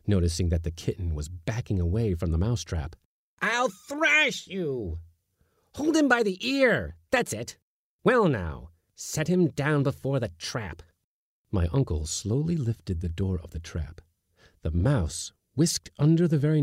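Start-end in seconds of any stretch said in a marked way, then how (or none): 3.4-10.8 very high, exasperated scolding. A narrator's reading. Uncle scolding the kitten to look at the mouse, high. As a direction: berate the cowardly kitten.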